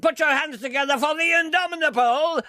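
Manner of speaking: high arena introduction, last word hugely stretched; Peter Dixon. Acted. Spoof announcer introducing an act, in a bellowed announcer voice. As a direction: build each epithet to a long howled name. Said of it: "Take this encounter with X Factor announcer Peter Dixon".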